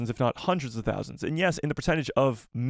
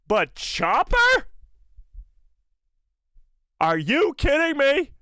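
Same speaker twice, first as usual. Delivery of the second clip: high, slow, stunned disbelief; incredulous; 12 semitones higher, 5 dB louder, slower than usual. Real, not acted. Streamer balking at a viewer's Chopper request, incredulous.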